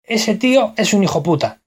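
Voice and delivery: Castilian accent, clipped "hijo puta" without the de; Spanish accent from Spain. Shown as a demonstration. Showing how a Spaniard would say the phrase, Spanish accent. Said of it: "El español diría".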